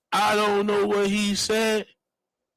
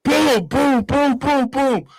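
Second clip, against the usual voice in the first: high-pitched run of booms; imitated crash booms; 9 semitones higher, much slower than usual. Passenger retelling the cab crash, imitated high booms. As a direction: voice each bump of the crash.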